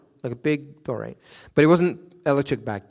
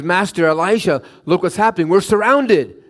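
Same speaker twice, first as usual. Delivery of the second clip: panicked servant; 5 semitones higher, 5 dB louder than usual. Acted. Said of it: "he begins to panic".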